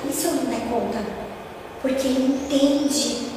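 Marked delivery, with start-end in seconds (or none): 0.1-1.0 soft, low, humble worry; humble question. Retold or imitated. Kardec humbly asks the spirits what if he fails, softly. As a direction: ask it with real humility. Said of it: "Não, ele pergunta".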